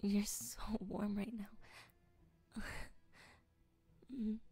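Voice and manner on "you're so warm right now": a slow whisper, much softer; slow tender whisper. Acted. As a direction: whisper it close and unhurried. Whispered ASMR roleplay.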